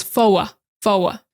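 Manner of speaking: "foa" said with no r, an open "oh-ah"; grandma's Boston for. Shown as a demonstration. Teacher imitating grandma's Boston "for". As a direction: drop the r and say "foa". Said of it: "instead of saying the word for".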